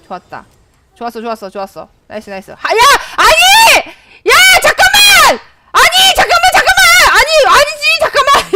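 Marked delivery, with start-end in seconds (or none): 2.7-8.5 very high, loud frantic shouts; panicked shouting. Real, not acted. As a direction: scream for them to wait. Panicked protest in a fight. (다급한 비명)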